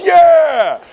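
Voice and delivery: put-on high, loud drunk whoop; group cheer. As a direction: shout it like the whole crowd. Everybody cheers together.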